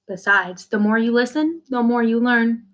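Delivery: ordinary delivery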